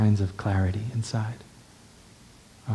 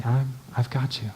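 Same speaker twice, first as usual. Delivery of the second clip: softer and softer, fading to a whisper; quiet tender words to oneself; self-soothing; 5 dB quieter than usual. Shown as a demonstration. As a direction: cradle your own pain gently, almost whispering. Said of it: "and I say".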